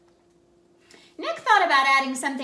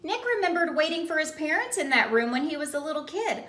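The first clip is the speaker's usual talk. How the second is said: usual speaking voice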